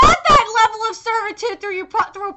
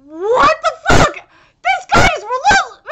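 raised, louder disbelief; shocked outburst; 4 semitones higher, 5 dB louder than usual. Real, not acted. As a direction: blurt it out, appalled. Shocked reaction to the manga.

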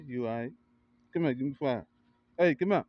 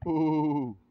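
loud ooh of hype; excited ooh; hyped ooh; 5 semitones higher, 4 dB louder than usual. Real, not acted. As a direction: cheer him on. Hyping the kid.

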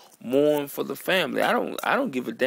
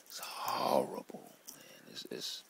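choking back tears, barely audible; real fight against tears; 14 dB quieter than usual. Real, not acted. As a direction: voice dropping to a whisper. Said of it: "I'm trying not to cry right now".

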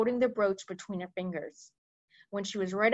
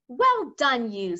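bright, louder praise; Sophie cries; 3 semitones higher, 6 dB louder, slower than usual. A narrator's reading. Sophie praising the BFG, loud and glad. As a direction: cheer him on. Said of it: "Sophie cried".

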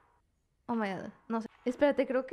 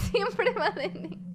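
laughing; 6 semitones higher, much slower than usual. Real, not acted. Laughs at repeated failure.

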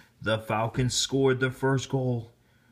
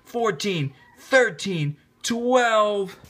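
crowd countdown; 8 semitones higher, much slower than usual. A narrator's reading. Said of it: "The crowd counted down".

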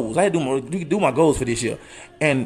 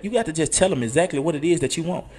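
ordinary delivery

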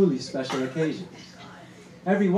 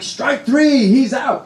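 loud, higher umpire-style call; cheering call; 8 semitones higher, 9 dB louder, much slower than usual. Retold or imitated. Cousin's fan at the baseball game calling the out, loud and high. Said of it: "I'm like".

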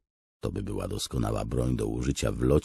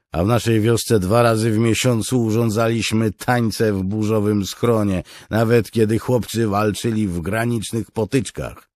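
raised, higher-pitched character voice; soldier in dialogue; 8 semitones higher, 6 dB louder than usual. A narrator's reading. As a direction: answer your comrade loud and high. Voices a soldier answering his comrade.

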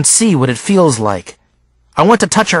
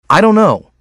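higher-pitched, mildly irritated snap; snapped reply; 6 semitones higher than usual. A narrator's reading. Boy rattled by his friends' questions, mildly snapping. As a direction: answer with a touch of snappish impatience. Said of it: "I snapped".

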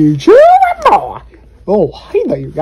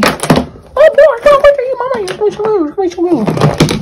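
put-on high-pitched doting girlfriend voice; squeaky sweet-talking partner; 13 semitones higher than usual. Girlfriend eager to eat.

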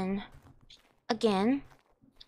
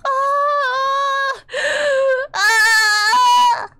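short high screams; panicked screams; 9 semitones higher, 5 dB louder than usual. Real, not acted. Dying in game, screaming.

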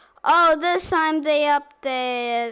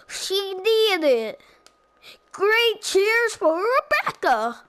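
higher, excited cartoon cheer; cartoon character voice; 6 semitones higher, 3 dB louder than usual. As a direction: cheer like a kids' show host. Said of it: "time for another one".

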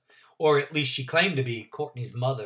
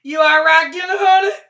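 put-on old country woman; 15 dB louder than usual. Trotter voice.

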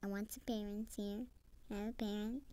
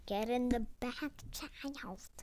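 a mock yell, a little louder; hammed-up yelled order; 4 semitones higher, 4 dB louder, slower than usual. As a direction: play the angry dad yelling. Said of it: "I thought he was gonna yell".

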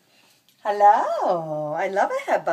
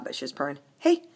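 ordinary talk

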